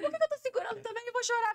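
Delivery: voz bem mais alta, quase chorando de brincadeira; desabafo agudo. Retold or imitated. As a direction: solte a decisão de chorar, agudo. Decide chorar lavando louça. (desabafo agudo e teatral antes do choro)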